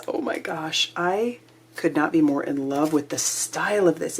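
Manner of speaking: ordinary delivery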